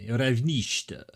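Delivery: Ravnishta said in a lightly put-on Russian accent; mock Russian accent. Reading a Bulgarian sign aloud, in a mock Russian accent. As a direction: roll out Ravnishta like a Russian would, lightly hammed. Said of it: "You gotta speak like a Russian".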